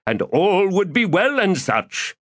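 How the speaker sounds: florid, theatrical gentleman rogue; lofty, self-pleased orator; pompous Crupper voice. Acted. Crupper speaks.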